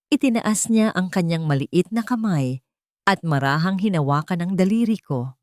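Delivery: ordinary delivery, nothing marked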